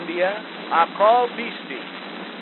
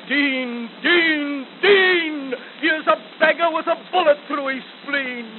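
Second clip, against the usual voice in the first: rising urgent plea; 8 semitones higher than usual. Acted. Wounded soldier begging for water, building urgency. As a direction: build to a desperate cry for water.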